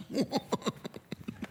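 high, breathy demonic cackle; a hushed, unearthly snicker; eerie laugh. Acted. Demon mocking the stranger, eerie breathy cackle.

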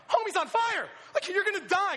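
high, loud excited yell; hammed-up panicked warning; alarmed shout. Preacher retelling his alarm at the rider, panicked shout.

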